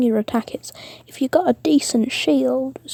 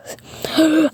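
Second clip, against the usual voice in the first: mock horrified gasp; sharp acted intake of breath; alarmed gasp; 6 semitones higher than usual.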